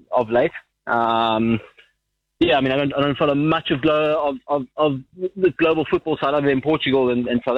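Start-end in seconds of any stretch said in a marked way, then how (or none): none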